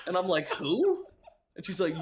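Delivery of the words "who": high, loud baffled yelp; a brother baffled by his sister's pick. Retold or imitated. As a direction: yelp it in total confusion. Retold confusion at player name.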